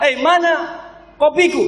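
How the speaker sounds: high, mock-irate demand; angry husband. Husband demanding his coffee from wife, mock angry. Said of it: "Ketika suami marah".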